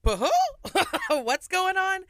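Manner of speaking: high, loud bewildered cry with a laugh; retold shock. Singer retelling rodeo cancellation, high stunned laugh. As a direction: act out the confusion, half laughing. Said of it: "I was like".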